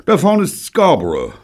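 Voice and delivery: high, loud and huffy; ungracious. A narrator's reading. Bunny challenged on his navigation, ungracious. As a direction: snap the place name out ungraciously. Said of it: "sagte Bunny ungnädig".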